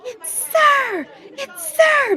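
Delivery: high shrill-pitched mocking shriek; mock scream. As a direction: screech it like the heckler. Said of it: "they start screaming".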